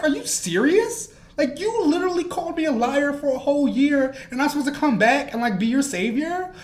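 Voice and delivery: high, loud indignant quoting; mock exasperated Harry. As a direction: throw back his outrage. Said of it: "And Harry was like".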